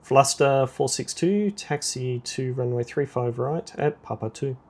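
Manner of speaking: normal talking voice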